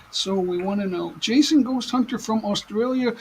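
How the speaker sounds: ordinary delivery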